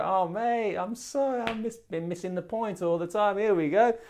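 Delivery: high, loud matey apology; friendly apology to the devil. Acted. People making friends with the devil, hammed-up apology. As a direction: gush an exaggerated, high-pitched sorry to a mate. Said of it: "we make friends with him, and we go".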